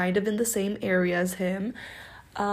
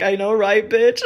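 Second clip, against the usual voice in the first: loud, teasing agreement that climbs louder; playful shout; 7 dB louder than usual. Retold or imitated. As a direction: shout it back to your girlfriend, teasing. Playful reply to friend.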